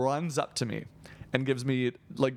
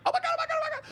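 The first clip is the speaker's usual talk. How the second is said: mock screaming; much faster than usual. Fan screaming as the creator leaves, mock squeal. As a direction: imitate a hysterical fan in a tiny falsetto. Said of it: "like, screaming as we're leaving".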